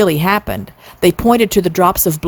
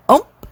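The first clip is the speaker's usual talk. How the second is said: fiddle ump; 9 semitones higher than usual. A narrator's reading. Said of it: "went the fiddle".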